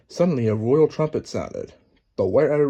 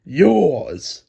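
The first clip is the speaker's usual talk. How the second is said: loud, barked accusation; furious Queen bellowing at Alice; 2 semitones higher, 6 dB louder than usual. Acted. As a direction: hurl it at Alice. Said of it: "she bellowed at Alice".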